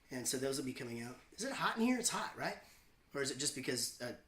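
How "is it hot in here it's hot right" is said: usual speaking voice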